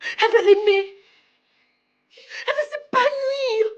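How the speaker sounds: crying plea. Acted. Pleading for her sick sister.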